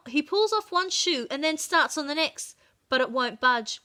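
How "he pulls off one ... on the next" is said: ordinary delivery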